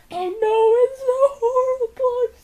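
high, slow, hammed-up whimpering heroine; mock sobbing. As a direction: whine it out like a melodramatic heroine. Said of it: "and now she's like".

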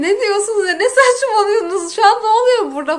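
retold baffled outburst; exasperated disbelief. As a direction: demand to know what on earth is going on.

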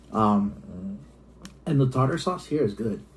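talking while biting sandwich; words muffled by food; mouth full. Real, not acted. Seen 0: Sandwich pressed to mouth, biting throughout the labelled words.

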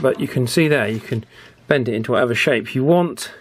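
ordinary talk

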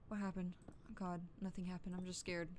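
usual speaking voice

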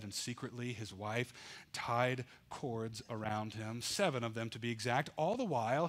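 usual speaking voice

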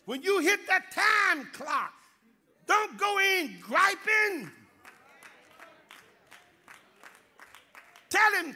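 loud, high, fervent preaching shout; preaching at full force. Acted. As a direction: drive it home to the congregation. Preacher exhorts the congregation.

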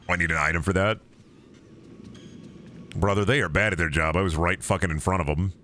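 ordinary talk

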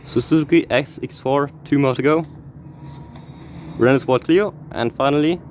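ordinary talk, nothing marked

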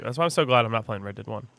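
usual speaking voice

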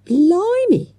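ordinary delivery

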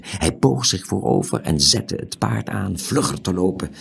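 ordinary delivery, nothing marked

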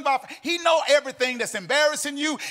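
loud, sung, whooping preacher cadence; full-force preaching. Acted. Preacher lifting the congregation, loud sung preaching. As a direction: preach it up over the organ.

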